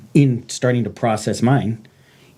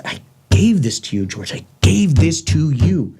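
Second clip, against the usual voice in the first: GAVE hit hard and high, then slowing on the repeat; emphatic answer; 7 semitones higher than usual. Retold or imitated. God answering the angry man, emphatic. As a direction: press "gave" firmly, spell out the repeat. God's firm answer in retold argument.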